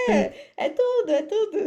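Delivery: breaks into loud high laughter mid-word; laughing. Real, not acted. Agreeing with her co-host while cracking up, laughing.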